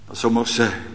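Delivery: ordinary talk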